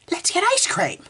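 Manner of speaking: very high cheerful mock parent. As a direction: chirp it like a clueless mom. Mocking the parent's answer.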